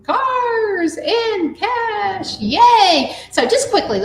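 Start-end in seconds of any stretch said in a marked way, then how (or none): 0.0-2.4 "cars and cash" drawn out slow and high; excited reveal. Shown as a demonstration. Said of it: "the letter C in our mind is". 2.4-3.2 cheering. Real, not acted. Cheering the answer reveal.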